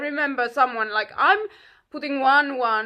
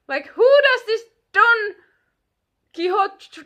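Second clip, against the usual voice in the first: indignant; 8 semitones higher, 8 dB louder than usual. Real, not acted. Indignant rant about the man.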